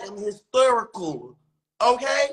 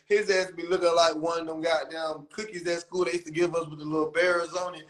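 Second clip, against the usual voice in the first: ordinary talk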